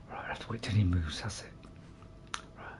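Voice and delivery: hushed whisper while sneaking. Real, not acted. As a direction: whisper so the guard won't hear. Sneaking past a guard.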